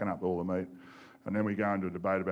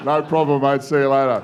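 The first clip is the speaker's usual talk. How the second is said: laughing through it; 4 semitones higher, 11 dB louder than usual. Retold or imitated.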